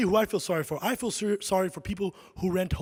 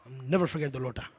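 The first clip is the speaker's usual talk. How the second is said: ordinary talk, nothing marked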